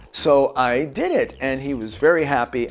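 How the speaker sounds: normal talking voice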